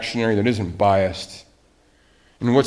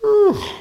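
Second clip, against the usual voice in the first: mock bored sigh; 4 dB louder than usual. Mimicking apathetic churchgoers.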